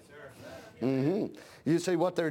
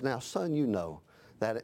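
low, soft, gentle voice of the Spirit; Holy Spirit speaking; 10 semitones lower, 3 dB quieter than usual. Acted. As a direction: speak down to him softly, like a father.

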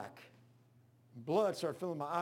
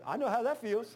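laughing through the line, pitched up; 7 semitones higher, 6 dB louder than usual. Real, not acted. To the heart attack patient, laughing it off. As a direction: crack up as you say it. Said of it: "I busted out laughing".

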